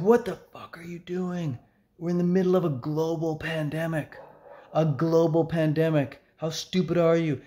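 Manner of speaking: mock rage, outraged and scolding; retold angry rant. Angry shopper in mask scolding the vlogger at checkout, mock rage. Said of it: "he's super angry at me".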